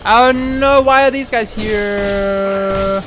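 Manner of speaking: loud, high wail that slows right down; drawn-out dismay. Real, not acted. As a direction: groan it out, dragging the last words. New enemies appear during play.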